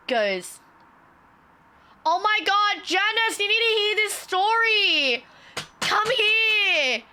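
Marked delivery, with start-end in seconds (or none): 2.0-7.0 high, drawn-out mock holler; hammed-up gleeful summons to a co-worker; mocked receptionist calling out.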